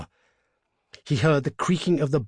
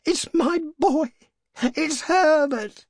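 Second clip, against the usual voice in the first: high, loud cry, slowing on the name; frantic cry; 5 dB louder, slower than usual. A narrator's reading. Mother struggling to reach her son, frantic cry. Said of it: "she cried".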